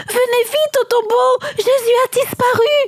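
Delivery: high, urgent cry of alarm. A narrator's reading. Marie to Pierre and Jean at the tomb, alarmed cry. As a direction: call the news out in a breathless high rush. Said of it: "cria-t-elle".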